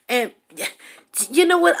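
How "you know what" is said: high, loud you know what; heated retort. Real, not acted. To the rival, high and heated. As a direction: snap it out, fed up.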